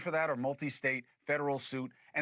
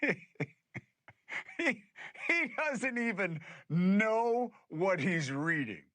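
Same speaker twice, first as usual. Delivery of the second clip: amused laughing; 4 semitones higher, 5 dB louder, slower than usual. Real, not acted. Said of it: "I'm not laughing 'cause I hate Trump".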